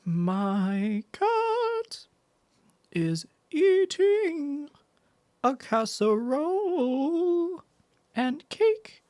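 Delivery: very high, drawn-out, stretched syllables; high-pitched mock recitation, slowly; mock correction. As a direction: stretch EATING and CASSEROLE out in a silly high voice. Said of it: "Wait, you said it wrong".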